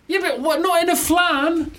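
very high, squeaky objection; comic exasperated squeal; incredulous protest. Real, not acted. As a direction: object in helpless high-pitched disbelief. Incredulous protest over the pun.

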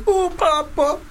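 high-pitched mock Italian exclamation; ridiculous Italian accent. Mocking the film's hero to his co-host, ridiculous Italian accent. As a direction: put on an annoying, silly Italian voice. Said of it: "ridiculous Italian accent".

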